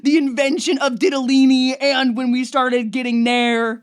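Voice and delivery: laughing conspiracy lecture; conspiracy theorist. Pretend. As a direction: pitch it like a wild theory, laughing. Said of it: "in my conspiracy theory class being like".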